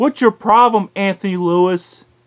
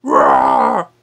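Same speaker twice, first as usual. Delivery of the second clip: low monster cry. Pretend.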